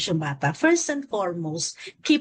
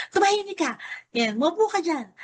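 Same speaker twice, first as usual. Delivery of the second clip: mock scolding; 8 semitones higher than usual. Parent yelling back at a crying child, high mock scolding. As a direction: imitate a parent ordering a child down. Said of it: "Kesa yung sinigawan mo rin yung bata".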